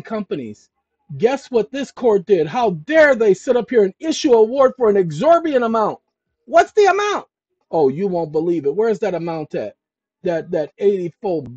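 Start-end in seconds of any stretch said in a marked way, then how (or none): 1.2-6.0 loud, indignant rant pitched high; rising outrage at the award; outraged. Acted. 6.5-7.3 very high, loud incredulous cry; hammed-up shocked question; mock astonished. Mock question building suspense.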